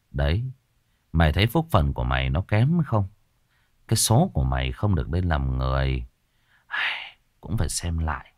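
low, soft and gentle; softened voice. A narrator's reading. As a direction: ease off and speak kindly to the ghost. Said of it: "Cậu dịu giọng".

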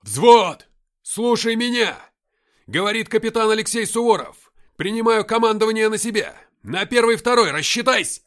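full-force high battlefield shout; shouted command. A narrator's reading. Captain taking command of the platoon, shouting. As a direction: yell orders through cupped hands. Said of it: "сложил руки лодочкой к рту и прокричал".

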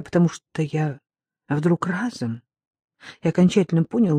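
ordinary delivery, nothing marked

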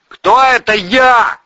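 loud, high, drawn-out yell; slow suspicious holler; shouted challenge. A narrator's reading. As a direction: demand it from far above. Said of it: "крикнули сверху".

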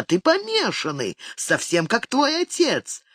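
mock yell, rising in pitch; wailing accusation. A narrator's reading. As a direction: hurl it as a narrated outburst. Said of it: "завопила она".

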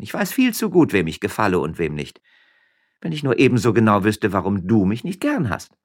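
high, lively miller's wife; Frasquita voice. A narrator's reading. Frasquita teasing her husband up in the vine, high. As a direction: pitch up, playful and teasing.

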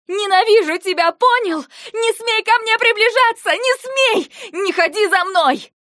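very high, loud screaming. Acted. Eva screaming at Lentar to stay away, hysterical scream. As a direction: scream with all your hatred. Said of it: "Я уже орала".

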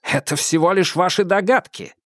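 high-pitched, irritable challenge; barked retort. A narrator's reading. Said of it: "рявкнул Адамс".

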